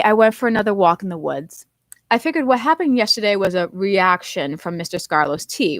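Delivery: usual speaking voice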